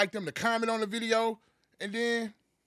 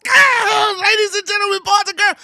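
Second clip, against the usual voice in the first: booming higher ring announcer call; hype man; 13 semitones higher, 15 dB louder than usual. Acted. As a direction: hype up the crowd, full volume. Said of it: "my man's about to go hard".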